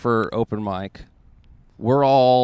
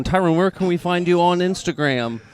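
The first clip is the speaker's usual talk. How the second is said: ordinary delivery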